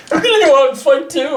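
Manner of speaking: put-on high eager kid voice; squeaky little kid cheering; kids' voice. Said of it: "all of his kids got together and were like".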